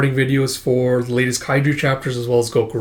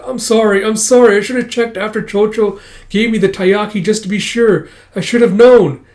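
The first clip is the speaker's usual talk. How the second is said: higher-pitched acted apology; flustered apology; 7 semitones higher, 5 dB louder than usual. Shinta to his master over taiyaki, flustered apology. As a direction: plead sorry in a raised, high voice.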